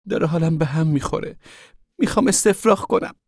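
high-pitched, whiny moan of nausea. A narrator's reading. Rosasharn tells mother she will be sick, moaning. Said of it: "روزا شارون نالید و گفت".

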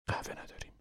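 whispered, trying not to wake anyone; hushed. Acted. Mother waking the family before dawn, whispering. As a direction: keep it to a whisper in the dark tent.

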